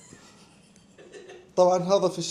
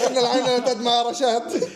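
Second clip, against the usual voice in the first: laughing through the line; 4 semitones higher, 4 dB louder than usual. Acted. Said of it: "طب قول تاني عشان أعرف".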